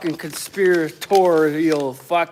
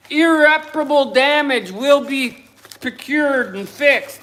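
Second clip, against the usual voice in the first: normal talking voice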